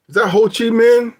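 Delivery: ordinary talk, nothing marked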